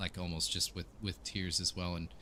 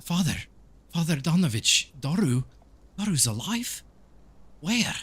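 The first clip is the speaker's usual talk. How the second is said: much higher, urgent character voice; startled Ismark; 9 semitones higher than usual. Acted. Man asking the priest if Doru's alive, high and urgent. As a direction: pitch it high with anxious, insistent questions. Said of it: "Ismark comes up behind him and he says".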